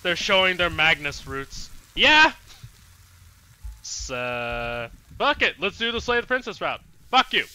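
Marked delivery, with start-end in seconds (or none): none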